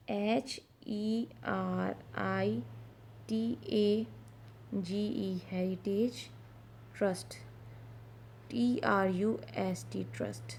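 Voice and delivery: slow, soft letter-by-letter spelling of "ایچ ای آر آئی ٹی اے جی ای", then the whole word; spelling aloud. Shown as a demonstration. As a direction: spell "ٹی آر یو ایس ٹی" one letter at a time, then say "ٹرسٹ". Teacher spells out English words. (ہجے کرتے ہوئے ایک ایک حرف ٹھہر کر، پھر پورا لفظ)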